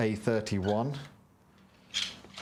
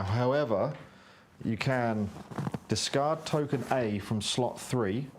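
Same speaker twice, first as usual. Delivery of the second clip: normal talking voice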